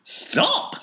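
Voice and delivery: loud, high cry to stop; urgent command. A narrator's reading. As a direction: call out to halt her at once. Wonka tries to stop her.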